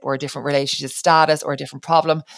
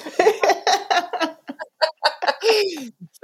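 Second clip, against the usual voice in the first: high laugh. Real, not acted. Laughing after nanny quote.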